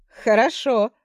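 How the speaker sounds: loud, high shout; shouting over wind. Acted. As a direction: yell it into the wind. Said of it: "прокричал он".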